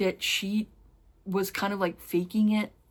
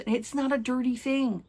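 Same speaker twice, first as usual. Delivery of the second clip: usual speaking voice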